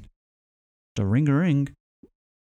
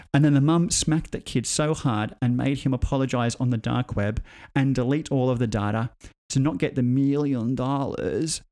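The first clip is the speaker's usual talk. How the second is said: usual speaking voice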